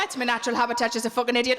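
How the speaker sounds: ordinary talk, nothing marked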